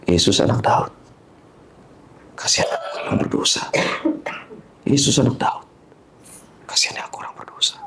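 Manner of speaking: hushed, repeated breath prayer; barely voiced rosary-like repetition; private whispered prayer. Shown as a demonstration. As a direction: murmur it privately, pausing between phrases. Demonstrates his private repeated prayer.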